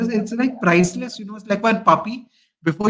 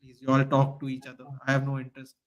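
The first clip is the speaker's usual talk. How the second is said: weary dismissal; 6 semitones lower, 7 dB quieter, faster than usual. Retold or imitated. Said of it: "I would have just gone like".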